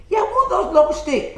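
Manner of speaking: put-on high, loud Chinese-accented interruption; mock Chinese accent. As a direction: butt in with caricatured Chinese English. Chinese customer cutting in.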